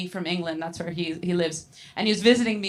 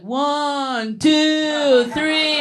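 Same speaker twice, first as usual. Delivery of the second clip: counting up louder and higher with each number; dad counting loudly; 5 semitones higher, 7 dB louder, much slower than usual. Retold or imitated. Dad counting his big vape hit, louder each number. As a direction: egg yourself on, one number at a time.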